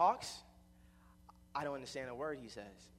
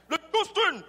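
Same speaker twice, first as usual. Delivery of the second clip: booming, raised Jamaican stepdad shout; stepfather's strong voice; 15 dB louder than usual. Retold or imitated. Jamaican stepfather summoning his stepson, loud mock-patois shout. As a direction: call out hard in a mock Jamaican accent. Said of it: "his voice is so strong and so deep".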